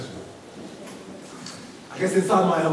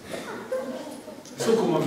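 mock teasing; 11 semitones higher, 3 dB quieter, much slower than usual.

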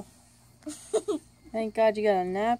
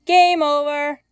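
loud game-host call; 5 semitones higher, 12 dB louder, much slower than usual. Pretend. Game host buzzer announcement.

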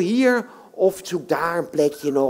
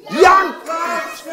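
loud call; 10 semitones higher, 4 dB louder, much slower than usual. Real, not acted. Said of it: "maar nu zo hard als je maar kan".

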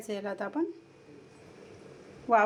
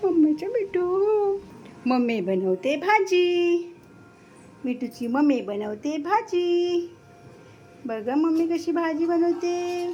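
higher, sing-song coaxing voice; pet talk; 6 semitones higher, 4 dB louder, slower than usual. Real, not acted. Mother coos to her parrot Mithu while cooking, in a high sing-song voice.

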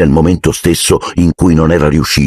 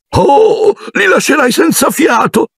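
ecstatic cry; slower than usual. A narrator's reading. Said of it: "gridò zia Petunia in estasi".

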